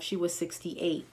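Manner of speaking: ordinary talk, nothing marked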